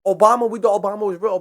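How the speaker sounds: ordinary talk, nothing marked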